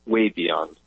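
normal talking voice

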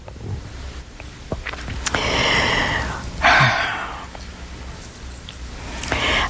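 long low breathy sigh; deep drawn-out sigh; long weary sigh. Real, not acted. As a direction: let out a long, tired breath.

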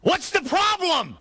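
high, drawn-out shout over the wind; yelling back. Acted. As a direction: holler the question across a storm. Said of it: "he has to yell back".

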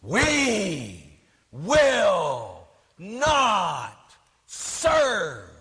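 very slow chant, each word stretched and falling; mock screaming nun. Nun rallying all the nuns at a conference, mock screaming. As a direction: imitate a podium-pounding protest cry, drawn out word by word. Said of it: "she's pounding on the podium".

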